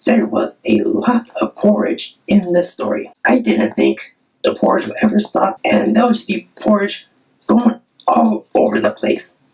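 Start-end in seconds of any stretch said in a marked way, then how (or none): none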